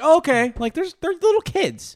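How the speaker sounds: high mock parent. Imitating relaxed parent.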